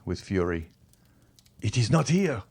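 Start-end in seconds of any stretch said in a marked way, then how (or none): 1.6-2.4 desperate cry, pitched up; raised cry. A narrator's reading. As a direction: call out in frantic denial. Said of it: "he cried".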